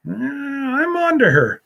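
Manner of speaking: teasing mock voice. Teasing Chloe on the livestream.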